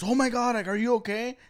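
high fussing voice; mock worried adult. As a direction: imitate a fretting grown-up.

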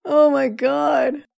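usual speaking voice

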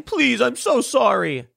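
high, slow hammed-up mock crying apology; mock sobbing. Mocking Ted Cruz on his knees apologizing, in a high, slow, fake sob. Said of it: "sobbing, crying".

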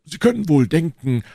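high, self-satisfied boasting; vain Porthos. Acted. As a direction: preen over your own good looks. (eitel und prahlerisch, mit hoher Stimme)

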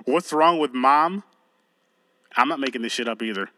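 ordinary talk

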